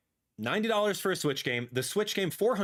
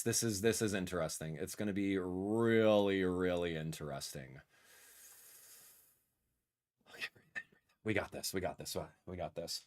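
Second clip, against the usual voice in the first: much lower, much softer, under his breath; quiet self pep talk; 8 semitones lower, 6 dB quieter than usual. Real, not acted. Streamer bracing himself before the Direct, murmuring a soft pep talk. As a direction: psych yourself up under your breath.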